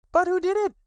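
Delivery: put-on very high little child's voice; eager small Roo; high excited question. Roo asks.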